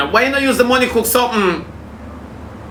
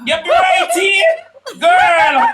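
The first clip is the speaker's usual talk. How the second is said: squealing praise; 9 semitones higher, much slower than usual. Real, not acted. Teasing friend about cat pants.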